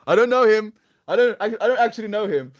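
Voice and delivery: high, loud laughing denial; giddy protest; excited. Real, not acted. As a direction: blurt it out amused.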